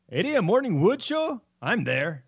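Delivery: bad Irish lilt, starting high; muddled Irish accent. Shown as a demonstration. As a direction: attempt Irish and land nowhere. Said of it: "don't know what accent".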